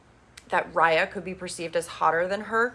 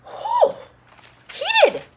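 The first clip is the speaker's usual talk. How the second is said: high, loud whistle-like whew; whew, heated; 6 dB louder than usual. Real, not acted. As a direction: react like the drama is too hot. Reacting to the slap scene.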